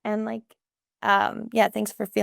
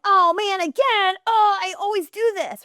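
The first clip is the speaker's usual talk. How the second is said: much higher and louder, near-crying frustration; whiny self-blame; 12 semitones higher, 5 dB louder than usual. Pretend. Parent modeling harsh self-talk in front of a kid, whining. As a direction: wail at your own mistake. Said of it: "Or am I being like".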